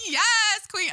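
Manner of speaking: high, loud squeal of self-praise; gleeful cheer. Pretend. Podcaster celebrating her rolled R, gleeful high cheer. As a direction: shriek it like your own hype squad.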